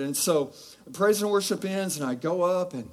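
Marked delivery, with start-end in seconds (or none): none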